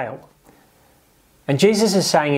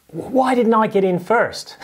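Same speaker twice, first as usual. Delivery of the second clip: higher, slow sulky whine; jealous whine; 7 semitones higher than usual. Retold or imitated. Disciple admitting envy of James and John, mock whiny. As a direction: let the sour grapes show. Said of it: "What they really mean is".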